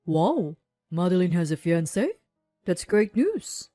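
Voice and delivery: pleasantly surprised. A narrator's reading. Friend hears happy news.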